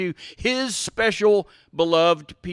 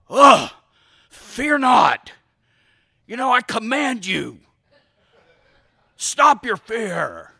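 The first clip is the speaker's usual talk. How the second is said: hammed-up, overbearing order with drawn-out ending; mock stern command; 2 semitones higher, 3 dB louder, slower than usual. Parent wrongly ordering a scared child, mock stern command. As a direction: overdo the commanding parent, exasperated. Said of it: "but differently".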